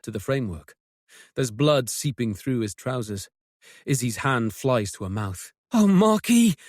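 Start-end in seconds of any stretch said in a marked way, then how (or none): 5.7-6.5 distressed. Acted. Sees his bleeding leg.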